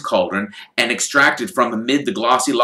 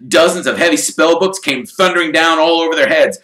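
plain reading voice

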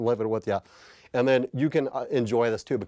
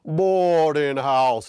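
drawn-out, louder trailer-voice title; trailer announcer; 4 semitones higher, 6 dB louder, much slower than usual. Retold or imitated. Trailer voice repeating the title, louder. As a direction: intone the title like a movie trailer. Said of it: "keep repeating the name of the movie".

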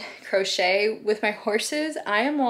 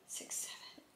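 whispered counting; 26 dB quieter than usual. Real, not acted. Counting boxes under breath.

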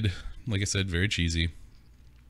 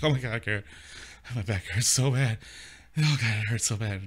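moaning mom; 4 semitones higher than usual. Retold or imitated. Mother moaning on phone.

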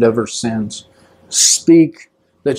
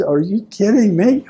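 higher-pitched, mildly incredulous protest; retold disbelief; 5 semitones higher than usual. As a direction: act out being stunned by the elder. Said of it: "I couldn't believe that".

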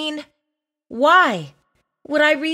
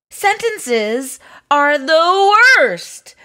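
slow, loud, drawn-out complaint; exasperated; 4 semitones higher, 5 dB louder, slower than usual. Acted. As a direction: drag out the ending in dramatic disgust. Reluctant reader rants about sentences.

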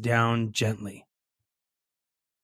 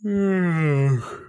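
long, falling weary sigh; drowsy groan gliding downward; sleepy sigh; 5 semitones higher than usual. A narrator's reading. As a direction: let out a high, drawn-out tired sigh. Sleepy mask starts to settle down.

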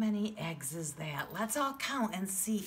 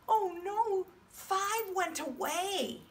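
higher, slow oh-no; mock dismay; 11 semitones higher, slower than usual. Shown as a demonstration. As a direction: ham up the sad surprise.

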